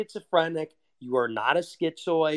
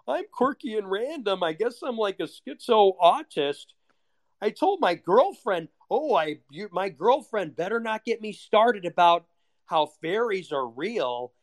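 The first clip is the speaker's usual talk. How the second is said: high mock poser voice, smug; mocking quirky poser; 6 semitones higher than usual. Mocking a quirky poser, high smug voice. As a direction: ham up a quirky online poser.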